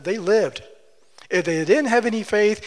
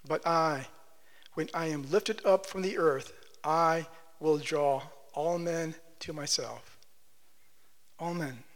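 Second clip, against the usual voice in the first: soft scripture reading; 2 semitones lower, 9 dB quieter than usual. Acted.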